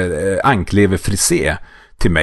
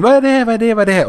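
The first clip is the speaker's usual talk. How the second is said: put-on curious children; 12 semitones higher, faster than usual. Kids rushing in curious.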